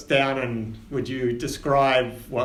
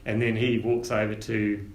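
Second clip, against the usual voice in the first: ordinary talk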